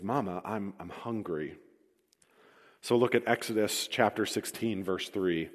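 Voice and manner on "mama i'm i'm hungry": put-on soft, hushed little child's voice; a hungry child tugging at his mother; child whimpering quietly to his mother; small boy's plea. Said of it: "a little boy tugs on his mother's skirts and says".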